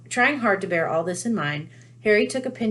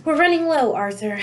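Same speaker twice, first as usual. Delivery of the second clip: sighing; 9 semitones higher, 5 dB louder than usual. A narrator's reading. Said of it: "she sighed".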